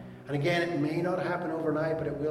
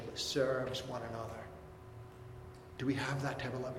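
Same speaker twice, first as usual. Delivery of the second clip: normal talking voice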